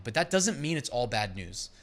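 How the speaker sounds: ordinary delivery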